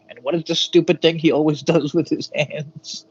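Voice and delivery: breaking into laughter mid-question; amused mockery. Real, not acted. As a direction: poke fun, laughing through the words. Mocking a wrestler's gesture, laughing.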